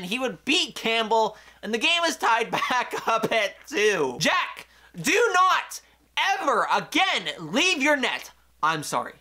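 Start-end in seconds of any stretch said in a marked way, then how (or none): 4.2-8.3 yelling at goalie. Real, not acted. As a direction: order him back into his net. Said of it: "I'm sorry for yelling at you".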